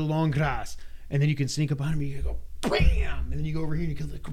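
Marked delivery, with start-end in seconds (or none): 2.6-3.3 high, sharp unvoiced bam; mock hit sound. Player strikes enemies from the grass, sharp bam. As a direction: snap out a punchy hit noise. Sneak attack noise.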